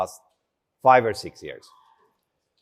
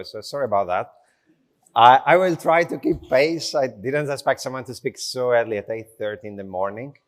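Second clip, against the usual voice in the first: ordinary talk, nothing marked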